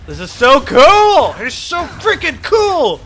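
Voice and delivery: usual speaking voice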